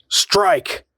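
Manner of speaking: high, sharp shout; sharp command. A narrator's reading. The voice ordering the girl to strike, a sharp high shout. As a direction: snap the order out.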